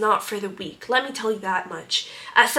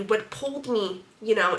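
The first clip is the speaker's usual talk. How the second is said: ordinary talk, nothing marked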